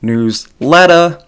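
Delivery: loud, drawn-out Boston "letta" with a big pitch jump; hammed-up Boston accent, the ER ending turned to AH. Shown as a demonstration. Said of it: "practice my Boston accent".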